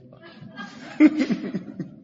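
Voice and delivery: breathy burst of laughter. Real, not acted. Preacher amused by his deathbed story, laughing. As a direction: laugh at your own anecdote.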